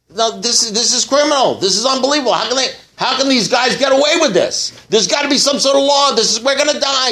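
high, frantic complaining castaway; panicked rant. Retold or imitated. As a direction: pour it out in rising alarm. Stranded man panics again.

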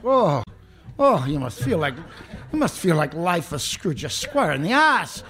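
high, loud, pitying priest voice; mock sympathetic priest. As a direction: pour on the fake pity. Priest's sympathetic reply, retold.

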